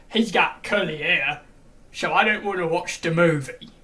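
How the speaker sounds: loud mocking fan voice; mock whiny fan. Mocking a shallow fan's complaint, loud mock voice. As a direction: sneer it like a petty fan.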